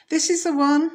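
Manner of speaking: high, loud discovery; triumphant. Acted. Expert announcing the monster's picture to his friend. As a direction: announce the find.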